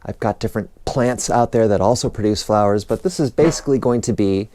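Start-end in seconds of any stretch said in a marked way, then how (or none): none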